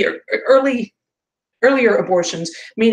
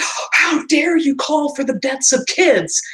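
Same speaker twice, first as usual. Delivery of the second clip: drawn-out, higher, appalled imitation; mock outrage; 4 semitones higher, slower than usual. Streamer imitating upset people reacting to death threats, mock outrage. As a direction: imitate scandalised people, stretching the words. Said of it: "everybody was upset".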